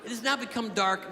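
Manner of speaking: usual speaking voice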